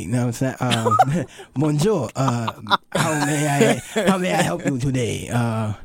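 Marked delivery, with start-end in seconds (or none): 1.5-5.7 shaky-skilled mock French waiter accent; American badly faking a French accent. Shown as a demonstration. Waiter asking a customer their order, French accent. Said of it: "You sound like an evil James Bond villain".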